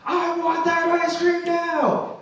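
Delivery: hammed-up kid's tantrum, stretched out; mock tantrum scream. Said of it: "he screams again".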